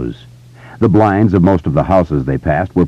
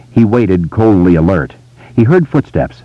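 usual speaking voice

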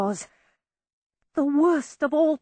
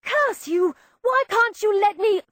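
outraged; 10 semitones higher than usual. A narrator's reading. Outraged girl at brother.

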